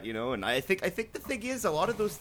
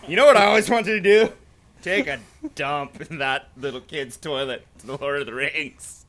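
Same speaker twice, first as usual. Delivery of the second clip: playful impression; 4 semitones higher, 9 dB louder than usual. Voicing Carter at the urinal.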